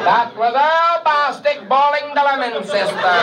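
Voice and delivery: put-on high, accented bandleader voice. Bandleader greets his new trumpet player, gushing. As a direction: gush like a schmaltzy TV bandleader. [name] voice continues.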